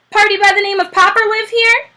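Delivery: hearty expressman. A narrator's reading. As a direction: call out like a brisk delivery man. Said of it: "expressman at the door".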